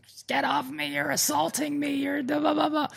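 very high, soft mock drunk whine; drunk person. Drunk driver pulled over whining at cops, high and thin. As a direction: squeak it like a belligerent drunk. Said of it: "people who are intoxicated".

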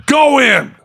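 high, yelled command to the doctor; insistent yell; hollered order. Retold or imitated. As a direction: send him in with a high holler. Retold patient ordering doctor in.

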